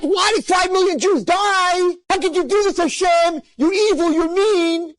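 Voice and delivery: high, loud accusing shout to the heavens; angry shout at God. Pretend. Speaker to the girls, voicing the accusing question not asked, high loud shout. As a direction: hurl the blame upward, shouting.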